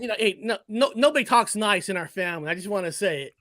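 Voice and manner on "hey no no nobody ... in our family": ordinary delivery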